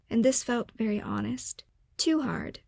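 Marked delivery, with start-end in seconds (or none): 2.0-2.6 said with a kind smile, pitch lifted; a gentle smiling correction. A narrator's reading. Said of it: "Doctor A said with a kind smile".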